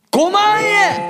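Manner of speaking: high, drawn-out shout of disbelief; stunned high-pitched echo; astonished shout. Real, not acted. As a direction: blurt it back in amazement. Astonished echo of the revealed amount.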